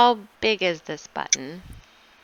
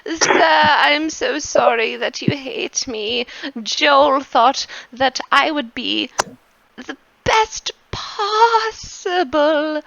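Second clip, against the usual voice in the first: high, slow, simpering apology; servant voice; 6 semitones higher, slower than usual. Acted. Servant Anton apologizes to his master, high and slow. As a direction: plead sweetly, drawn out.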